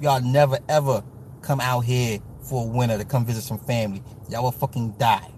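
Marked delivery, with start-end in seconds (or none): none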